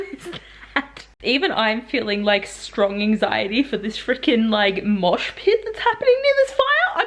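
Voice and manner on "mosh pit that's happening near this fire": alarmed squeal. Real, not acted. Vlogger fretting over the fire scene, high. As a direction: pitch up in alarm.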